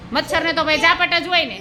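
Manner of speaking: ordinary delivery, nothing marked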